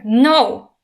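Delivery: loud commanding cry; defiant shout at the dragon; screamed no. A narrator's reading. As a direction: shout it like a lash. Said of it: "she screamed again".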